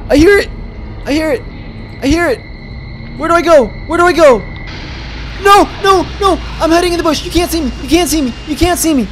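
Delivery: high-pitched panicked repetition; frantic panic. Real, not acted. Player hiding from the monster, frantic panic. Hearing threat, hiding in bush.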